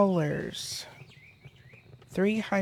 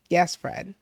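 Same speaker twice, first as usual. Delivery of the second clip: usual speaking voice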